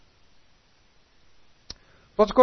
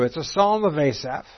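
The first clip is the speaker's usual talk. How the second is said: ordinary talk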